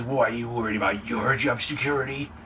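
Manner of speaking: low, lightly put-on boss voice; mock authority in a low voice; put-on Vince voice. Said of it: "I don't know why I did that voice".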